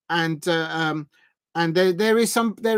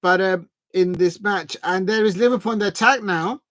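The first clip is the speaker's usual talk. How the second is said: ordinary delivery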